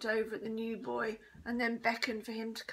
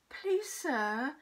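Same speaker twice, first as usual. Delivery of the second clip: higher whiny plea to a teacher; wailing; 4 semitones higher, 4 dB louder than usual. A narrator's reading. Bully complains to the teacher, wailing. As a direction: put on a schoolboy's wail. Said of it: "wailed Brendan the bully".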